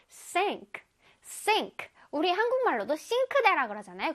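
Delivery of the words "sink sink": "sink" said softly and clearly, softer; gentle, distinct "sink". Shown as a demonstration. Teacher demonstrates a soft, clear "sink" for kids. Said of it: "부드럽게, 또렷하게 발음해줄게요".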